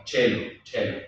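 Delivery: celum starting with a CH sound; church Latin pronunciation. Shown as a demonstration. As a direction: say celum as "chelum". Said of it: "sino celum".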